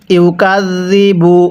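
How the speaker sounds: ইউকাযযিবু with soft zal, slow recitation; drawn-out ইউকাযযিবু, zal said softly. Shown as a demonstration. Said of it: "আরেকবার পড়ুন". (ইউকাযযিবু নরম জাল দিয়ে ধীরে)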